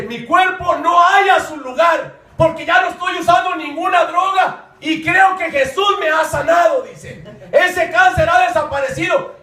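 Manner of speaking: retold testimony at sermon pitch; high, loud, exultant retelling; preached testimony. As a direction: proclaim another person's healing fervently. Preaches the healed man's testimony.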